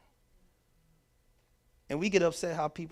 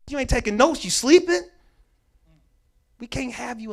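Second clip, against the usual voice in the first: classmate scolding; 8 semitones higher, 6 dB louder than usual. Retold or imitated. Classmates list his faults.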